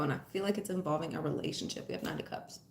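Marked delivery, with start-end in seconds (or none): 1.9-2.6 whispering; breathy under-breath aside; whispered aside. Real, not acted.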